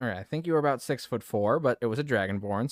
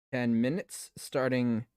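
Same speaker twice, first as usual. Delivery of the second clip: ordinary delivery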